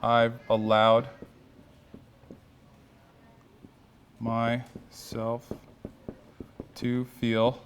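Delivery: long pauses between "I've allowed", "myself" and "to feel"; slow, spaced-out mantra. Shown as a demonstration. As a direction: lead the group slowly, leaving gaps for them to follow. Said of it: "I'm gonna start this one out".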